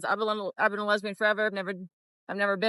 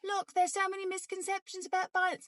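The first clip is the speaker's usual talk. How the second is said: higher, laughing mock British accent; 8 semitones higher than usual. To the viewers, still mocking in a British accent. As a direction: keep the put-on British accent going, sarcastic. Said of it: "I don't know why I'm doing a British accent".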